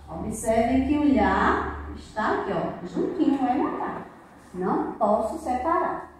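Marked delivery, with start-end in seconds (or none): none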